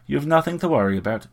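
lightly fussy, sure of herself; fussy self-assurance. A narrator's reading. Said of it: "with that same fussy self-assurance".

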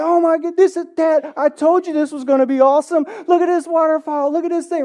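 high, loud imitation of a thrilled kid; excited child. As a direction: act out a little boy bursting with excitement. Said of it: "he's all excited".

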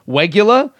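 "regular" as "wegular", high and questioning; mock mispronunciation. Podcaster teasing a fan's mate, "regular" as "wegular". Mock repeat of the word mid-quote.

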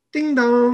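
high, sung-out two-note chime; imitated doorbell.

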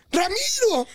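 high shouted Ramiro; mock shout. Teen yells for the missing classmate, high mock shout. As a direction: call Ramiro out, high and drawn out. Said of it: "yo grito".

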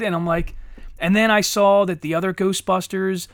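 ordinary talk, nothing marked

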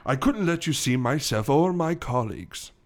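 put-on polite, hesitant old English gentleman; older English accent. Gorilla admitting the frying pan attack, polite older English accent. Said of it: "starting with a older English accent".

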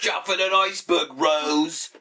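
high mock Boston-English-Australian accent; bad mixed accent. Spoofing the Titanic lovers, bad mock Australian accent. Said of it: "suck at every accent".

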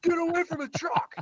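high-pitched pretend holler; mock yell. Friend pretending to warn off the truck guy, high mock yell. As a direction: call it out high, without full volume. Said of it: "I was gonna yell".

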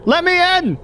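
shouting. Acted. Man shouting at door.

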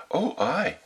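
put-on very high little-girl reply. A narrator's reading. Child answering the wolf's question, little-girl voice. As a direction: chirp it as an innocent child.

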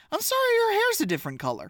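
high, fast, disbelieving; can't believe what she's seeing; high-pitched disbelief; incredulous. Real, not acted. Incredulous about hair color.

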